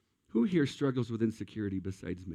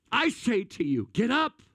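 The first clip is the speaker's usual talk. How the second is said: louder and higher, rising on get up; commanding proclamation; 10 semitones higher, 4 dB louder than usual. Acted. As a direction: command the dead girl to rise. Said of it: "Jesus commanding the girl".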